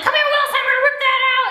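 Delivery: very high, urgent mock scolding; hammed-up exasperated teacher; imitated bossy teacher. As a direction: order a pupil over, high-pitched and exaggerated. Teacher ordering crying kid over.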